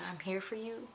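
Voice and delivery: soft, tender voice of a retold mom; gentle reassurance. Mother comforting her pregnant daughter, gently reassuring.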